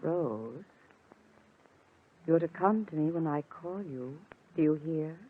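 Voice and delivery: soft, measured, insistent instruction; hypnotic command. Acted. Helen instructs her drugged sister, hypnotically. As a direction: plant the order quietly in her sleepy mind.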